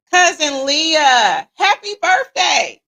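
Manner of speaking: loud, high, drawn-out greeting; big celebratory birthday holler; excited birthday shout-out. Real, not acted. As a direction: holler it out, stretching the words. Birthday shout-out to her cousin.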